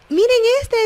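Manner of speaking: contemptuous mockery. Mical sneering at dancing David, high contemptuous scoff. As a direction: scoff at him like a disdainful wife. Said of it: "con un tono despectivo".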